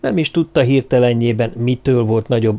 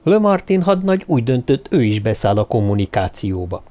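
plain reading voice

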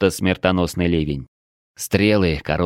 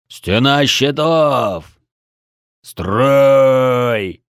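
raised, drawn-out battle command; beastly roar; 5 semitones higher, 5 dB louder, much slower than usual. A narrator's reading. Axe-wielding warrior orders the shield wall, long roaring shout. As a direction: roar each word slowly to the whole line. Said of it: "проревел звероподобный Бедвар".

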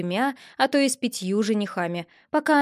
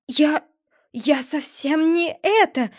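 slow, higher stammer; stammering in surprise; 5 semitones higher, slower than usual. A narrator's reading. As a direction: fumble for words, stunned. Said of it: "изумлённо пролепетала Милариса".

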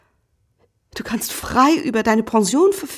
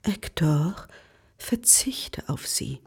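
slow, much softer whisper; whispered request to husband; 6 semitones lower, 6 dB quieter, slower than usual. Acted. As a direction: plead under the breath. Wife asks him give up.

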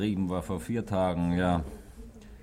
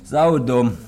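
exasperated outburst; 5 semitones higher, 10 dB louder than usual. Acted. Drunk man's outburst of disgust.

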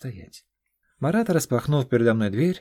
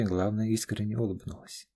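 plain reading voice

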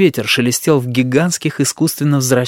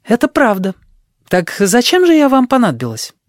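put-on young woman voice; 10 semitones higher than usual. Sheila's voiced lines.

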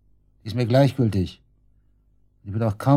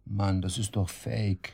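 stunned, slow disbelief; 6 dB quieter, much slower than usual. Acted. Suspect stunned by the photo.

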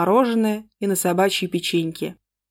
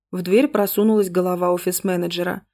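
plain reading voice

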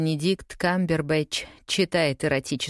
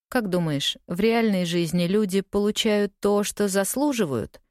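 ordinary delivery, nothing marked

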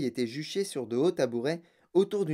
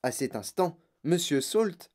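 plain reading voice

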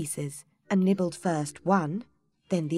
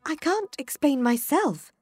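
put-on young Alice; 9 semitones higher, 4 dB louder than usual. A narrator's reading. Alice voiced in story.

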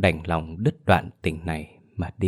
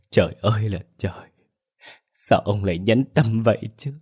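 wailing widow; 3 semitones higher than usual. A narrator's reading. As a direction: voice rising into a pleading sob. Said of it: "Tiếng khóc xé lòng". (vợ khóc than gọi chồng, giọng nức nở van xin)

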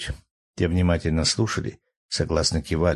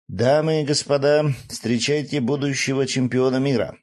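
booming ring announcer, pitched up; arena announcer; 7 semitones higher than usual. Retold or imitated. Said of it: "примерив на себя роль ринг-анонсера".

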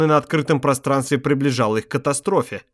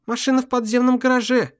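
higher, lightly voiced woman; Carol's voice; 9 semitones higher than usual. A narrator's reading. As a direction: read her line a touch higher. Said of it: "сказала она".

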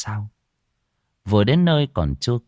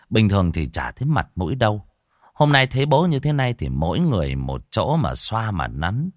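ordinary delivery, nothing marked

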